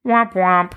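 sad trombone sound, sung; mock deflating womp womp. Sarcastic sound effect after failure.

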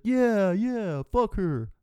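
high, loud sneering agreement from the crowd; jeering fake bystander voice; mocking bystanders. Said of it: "the rest of us are gonna be like".

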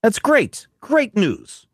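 high, hearty officer voice; Brigadier voice. Acted. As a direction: greet the agent like an old commander.

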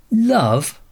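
high incredulous scoff. A narrator's reading. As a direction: scoff at the word, disbelieving. Scoffs at love story.

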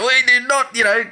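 high, emphatic retold protest; mock indignant abuse. Imitating the mayor abusing everyone about criminals, mock indignant. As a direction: imitate an affronted official's denial. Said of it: "abusing everyone about".

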